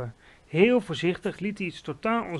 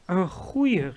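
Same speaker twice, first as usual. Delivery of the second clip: mildly raised, eager cry; excited exclamation; 3 semitones higher, 4 dB louder than usual. A narrator's reading. Giant answering Sophie about his catch, excited exclamation. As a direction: echo it with enthusiasm. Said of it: "riep hij".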